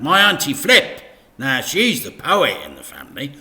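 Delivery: eager to pass the job on; Dad's voice. A narrator's reading. Dad pointing the vicar to Auntie Flip, eager.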